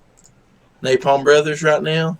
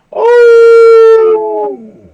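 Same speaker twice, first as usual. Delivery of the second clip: long, loud high howl; imitated dog howl, held on one note; 12 dB louder than usual. Shown as a demonstration. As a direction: howl like a dog to get it joining in.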